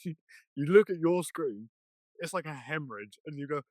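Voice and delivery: ordinary talk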